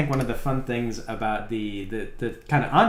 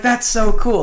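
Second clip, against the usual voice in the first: put-on much higher and louder, gushing child; excited kid; 10 semitones higher, 6 dB louder than usual. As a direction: play the kid who is thrilled. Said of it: "And he got super excited".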